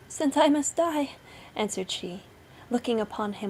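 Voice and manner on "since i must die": high-pitched condemned wife; pleading wife. A narrator's reading. Condemned wife answers her husband.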